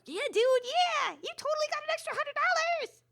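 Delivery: a gleeful little elf high-fiving the con; Keebler elf. Retold or imitated. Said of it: "That's my Keebler elf impression".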